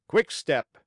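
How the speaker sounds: brisk circus command; called command. A narrator's reading. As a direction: call the next gait to the donkey. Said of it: "again the voice of the manager called".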